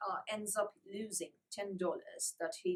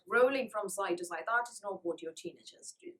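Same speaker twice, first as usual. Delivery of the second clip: ordinary talk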